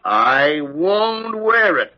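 slow, dragged out, leaning on "wear"; stubborn refusal. Acted. Gillespie refusing Parker's suit, slow and stubborn. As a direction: dig in your heels on "wear", slowly.

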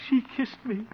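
high, squeaky lovestruck wonder; love-dazed, high-pitched glee; giddy amazement. Acted. Giddy after being kissed.

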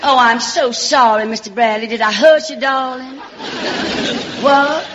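sugary Southern belle voice, sweet and coy; Southern belle on a visit. Acted. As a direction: play a pushy Alabama belle charming her way in. Belle apologises after throwing him.

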